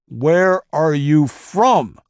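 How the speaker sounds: interrogator's savage drawn-out scream; furious scream. Acted. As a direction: tear the answer out of him. Interrogator repeats during torture.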